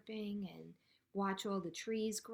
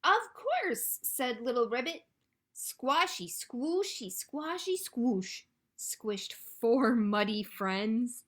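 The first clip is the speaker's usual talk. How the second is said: animated storytime reading; 6 dB louder, slower than usual. A narrator's reading. Frog welcomes duckling into the mud, animated storytime delivery. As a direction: play up the mushy words and stretch the ending.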